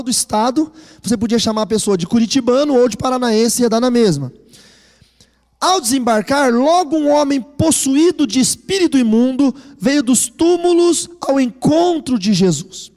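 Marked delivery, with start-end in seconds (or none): 5.6-12.9 slow, louder solemn Bible reading; scripture reading. Acted. Pastor reading Mark to the church, slow and solemn. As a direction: lay each phrase down slowly for the congregation.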